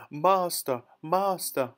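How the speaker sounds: high-pitched call for help; crying out. A narrator's reading. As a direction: cry out as the alarmed magic harp. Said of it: "the harp cried out again".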